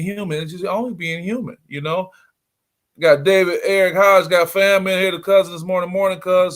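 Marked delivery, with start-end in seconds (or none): 3.0-6.5 loud, high roll call of names; excited shoutout. Real, not acted. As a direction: greet each viewer with energy. Shouting out viewers on stream.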